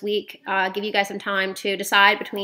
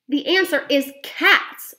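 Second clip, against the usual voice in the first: slow, louder declaration; shouted; 6 semitones higher, 4 dB louder, slower than usual. A narrator's reading. Boy reveals his plan to grandmother, slow proud shout. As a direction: spell out the answer triumphantly. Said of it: "I shouted".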